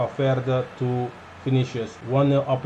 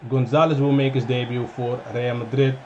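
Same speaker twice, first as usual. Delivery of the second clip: ordinary talk, nothing marked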